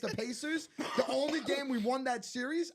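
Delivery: high, insistent self-defence; heated rebuttal. Real, not acted. Fan proves he was in the Garden, heated and high.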